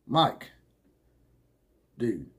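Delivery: ordinary talk, nothing marked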